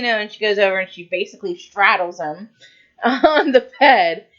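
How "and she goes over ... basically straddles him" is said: normal talking voice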